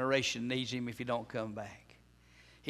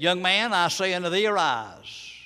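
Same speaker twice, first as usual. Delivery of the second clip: commanding call; 5 semitones higher, 7 dB louder than usual. Acted. Jesus commands the dead boy.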